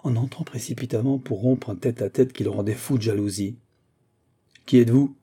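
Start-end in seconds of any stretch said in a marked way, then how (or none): 4.7-5.2 loud, authoritative challenge; acted fierce challenge to an intruder; savage demand. A narrator's reading. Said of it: "demanda sauvagement Ericsson".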